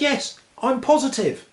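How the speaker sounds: high and slow, pleased with himself; slow high cheery voice. Acted. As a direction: deliver the punchline slowly, high. Punchline, atom answers.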